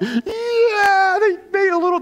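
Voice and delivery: high, loud pretend cheer; cheering parent. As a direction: cheer like a proud T-ball parent. Parent cheering a T-ball hit.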